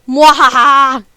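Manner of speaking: loud slow villain laugh; evil cackle. Retold or imitated. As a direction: cackle like a cartoon baddie. Mommy revealed as evil.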